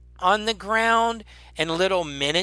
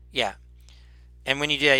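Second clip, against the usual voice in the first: ordinary talk, nothing marked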